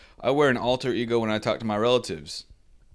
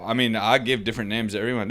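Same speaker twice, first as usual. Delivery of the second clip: ordinary delivery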